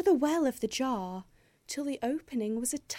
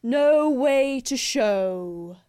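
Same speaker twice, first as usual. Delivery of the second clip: slow, loud howled wolf reply; howling wolves; 7 dB louder, slower than usual. Acted. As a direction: howl the words like wolves. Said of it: "they howled".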